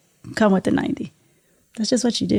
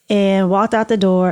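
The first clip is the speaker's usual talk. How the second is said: usual speaking voice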